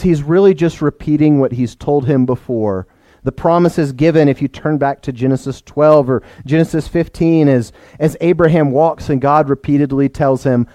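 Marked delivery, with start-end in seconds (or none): none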